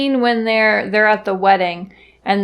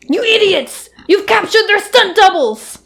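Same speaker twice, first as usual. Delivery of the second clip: very high, frantic yelling; shrill villain outburst; 12 semitones higher, 4 dB louder than usual. Retold or imitated. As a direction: screech at your bumbling troops.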